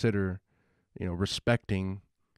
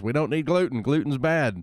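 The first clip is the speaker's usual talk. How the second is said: mock health nut; 5 semitones higher, 6 dB louder than usual. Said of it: "everybody else is".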